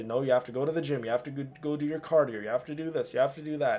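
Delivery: fast, pushy self-talk; inner voice pep talk. Retold or imitated. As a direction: rattle off orders to yourself quickly. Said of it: "argue with myself in my own head".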